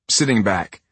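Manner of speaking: usual speaking voice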